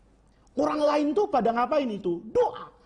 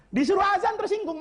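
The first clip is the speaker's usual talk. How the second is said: said much higher, pushed with indignation; indignant emphasis; 9 semitones higher than usual. Shown as a demonstration. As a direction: hit it high to show offended pride.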